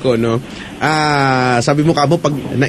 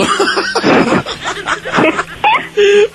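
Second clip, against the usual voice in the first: high shrieking laugh. Real, not acted. Friend bursts at dramatic reply. (malakas na halakhak)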